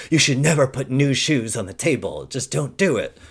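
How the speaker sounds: high, loud, insistent admonition; hammed-up superstitious scolding; mock stern warning. Superstitious mother warning about shoes on the table, mock alarm.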